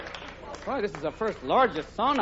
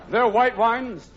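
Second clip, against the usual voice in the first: slow mock sommelier; 4 semitones higher, 3 dB louder, much slower than usual. Shown as a demonstration. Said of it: "repeats earlier wine line slowly".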